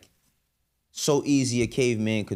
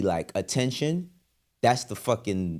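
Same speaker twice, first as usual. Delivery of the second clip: ordinary delivery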